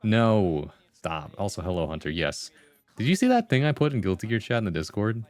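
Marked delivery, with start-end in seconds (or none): none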